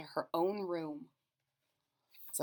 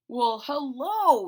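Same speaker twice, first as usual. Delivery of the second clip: watchman greeting; 5 semitones higher, 9 dB louder, slower than usual. A narrator's reading. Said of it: "he said".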